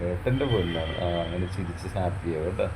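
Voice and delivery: dote on her as she smiles; tender praise. Acted. Husband doting on his wife as she finally smiles, tender.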